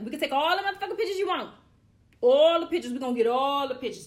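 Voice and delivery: loud, fast, high hype about pictures; hyped up. Real, not acted. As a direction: pump it up, all the pictures. Excited about taking pictures.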